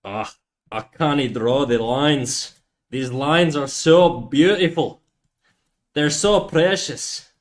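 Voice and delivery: slow, drawn-out mock Scottish accent; lightly done Scottish accent. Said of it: "the Scottish accent be".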